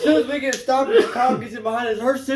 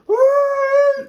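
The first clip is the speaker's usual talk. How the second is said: high, loud screeching whoop; imitated tire squeal; 6 dB louder than usual. Storyteller imitating the car taking a corner, tire squeal. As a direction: squeal like skidding tires. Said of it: "Tires squealing".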